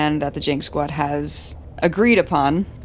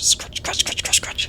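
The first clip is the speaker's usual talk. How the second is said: soft whispered "scratch" over and over; playful hushed scratching sounds; whispered scratches; 3 dB quieter than usual. Acted. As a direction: whisper it like scratching a dog's back. Scratching the dog's back.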